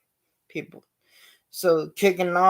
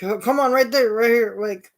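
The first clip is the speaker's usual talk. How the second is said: mocking crowd; 6 semitones higher, 4 dB louder than usual. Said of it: "they was like".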